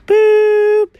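high held mouth beep; voicemail beep. Acted. Cat playing the answering machine, high beep. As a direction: make the tone before the message.

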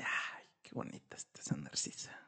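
breathy unvoiced whisper. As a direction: hush it like a secret. Susurra lo que dice Montgomery.

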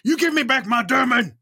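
high-pitched, demanding yell; in-character yell. Acted. Player yelling at the attacker who took a companion, high yell. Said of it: "I'm just yelling at this guy".

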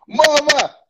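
high, loud shout of a greeting; calling out. Real, not acted. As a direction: call her name across the room. Greeting a viewer who joins live.

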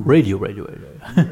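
low bass voice, fading to a breath; extremely deep bass voice. Shown as a demonstration. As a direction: rumble it low like a jingle bass. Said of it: "ich mach die tiefe Stimme".

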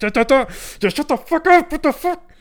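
high, shrill-edged raging yell; keyboard rage. Retold or imitated. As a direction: explode at the screen in fury. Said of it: "start fucking raging on the keyboard".

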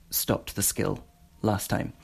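ordinary talk, nothing marked

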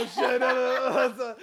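laughing panicked shout. Real, not acted. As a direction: yell it through laughter. Friend threatens to leak recordings.

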